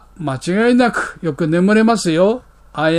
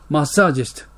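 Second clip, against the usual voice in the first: usual speaking voice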